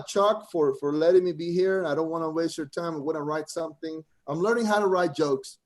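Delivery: ordinary delivery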